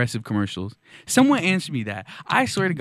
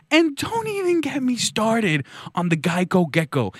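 exasperated, higher-pitched comic rant; 6 semitones higher than usual. Pretend. Comedian winds up a rant on insurance ads, mock-outraged. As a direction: launch a mock-outraged tirade.

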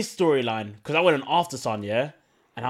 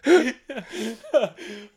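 much higher, hearty laugh; burst of laughter; 8 semitones higher than usual. Real, not acted. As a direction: laugh out at the memory.

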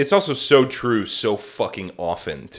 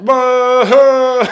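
long, loud, high wailing Ah; drunk Orson Welles; 11 semitones higher, 9 dB louder than usual. Retold or imitated. Mimicking drunk Orson's commercial opening, grandly wailed. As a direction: bellow one drawn-out boozy Ah. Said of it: "it's just like that".

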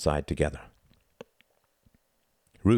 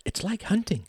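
put-on high, small boy's thrill; high-pitched eager little boy; unbearably excited. A narrator's reading. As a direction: voice a child's hushed excitement without shouting. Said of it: "unbearably excited".

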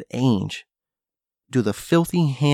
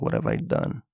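inner regret; 13 semitones lower, 5 dB quieter than usual. Retold or imitated. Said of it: "they think".

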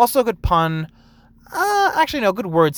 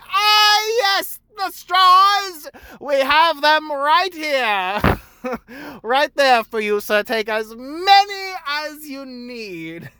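much higher, slow, drawn-out prim service voice; exaggerated service voice; 11 semitones higher, 4 dB louder, much slower than usual. Shown as a demonstration. Barista trying a new line on customers, slow prim high parody. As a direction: stretch it out like a parody of perfect service. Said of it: "maybe I should just say it like that".

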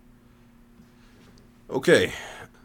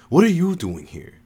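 higher, louder hostile question; spat out; 9 semitones higher, 5 dB louder than usual. A narrator's reading. Zipporah confronting the woman she hates, spitting it out. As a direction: spit it at an unwelcome guest. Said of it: "Zipporah spat at her".

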